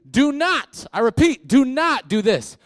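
high, loud and emphatic; loud warning. Real, not acted. Pastor warns the congregation, loud and high. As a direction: plead with them not to do it.

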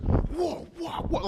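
high-pitched mock swinging noises; quick, high "whoa" noises for each swing of a cord; imitated whipping sounds. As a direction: act out flailing blows with high whooping noises.

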